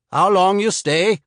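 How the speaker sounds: put-on wary Swede sizing up a stranger, slight accent; slight Swedish accent. Swede asks the traveler who sent him, slight Swedish accent. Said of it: "He spoke with only a slight accent".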